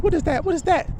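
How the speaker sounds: high squeaky panicked question; scared sheep. Acted. Young sheep hearing a strange sound, high frightened squeak. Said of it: "They're like".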